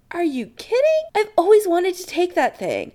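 high, thrilled rush; excited. Acted. Adam to Chris about the potion, excited. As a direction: gush in delighted disbelief.

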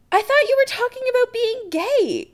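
very high, baffled outburst. Acted. Chris to Adam over a misunderstanding, baffled. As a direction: pitch way up in bewilderment.

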